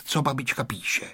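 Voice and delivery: usual speaking voice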